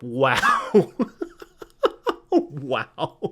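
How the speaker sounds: incredulous laugh between two wows; real, astonished laughter; laughing disbelief. Real, not acted. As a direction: laugh in amazement at the nerve of it. Disbelief at the retold entitlement.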